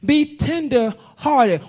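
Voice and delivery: slow, drawn-out, tender words; slow tender plea. Acted. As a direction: stretch each word out gently. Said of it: "He says".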